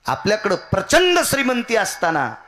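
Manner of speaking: high, loud kirtan cry to the gods; preaching at full force. Acted. Preacher crying out to the gods in kirtan. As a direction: belt it out like a temple preacher.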